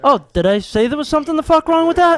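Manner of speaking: loud, high-pitched mock outrage; indignant comeback. Janitor answers people's pity, indignant. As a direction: fire back, offended and incredulous. Snapping back at pitying reaction.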